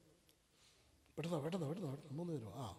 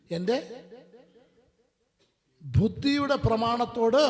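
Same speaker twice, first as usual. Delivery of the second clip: slow, loud, proclaiming scripture reading; full-force preaching; 4 semitones higher, 9 dB louder, slower than usual. Acted. Preacher proclaiming Romans to the congregation, loud and slow. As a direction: thunder the verse out slowly to the hall.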